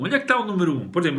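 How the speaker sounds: normal talking voice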